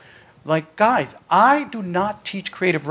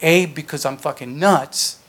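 ordinary delivery

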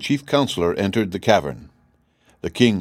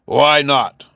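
demanding; 6 semitones higher, 6 dB louder than usual. A narrator's reading. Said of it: "asked the king".

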